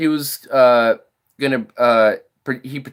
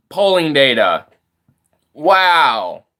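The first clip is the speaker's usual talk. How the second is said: ordinary talk, nothing marked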